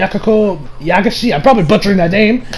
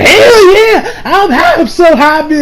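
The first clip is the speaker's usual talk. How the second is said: much higher, bursting with glee; overjoyed Koichi; 8 semitones higher, slower than usual. Retold or imitated. Koichi reacts to hearing she loves him, high and gleeful. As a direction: cheer like you just won. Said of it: "Koichi's like".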